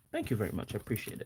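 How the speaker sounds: soft trailing thanks. Real, not acted. Closing a teasing rant.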